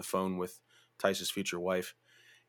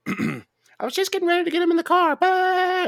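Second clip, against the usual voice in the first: much higher, louder put-on voice; mock girlfriend voice; 7 dB louder than usual. Girlfriend complaining she was getting him into the car, hammed-up high whine. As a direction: keep the exaggerated girlfriend whine.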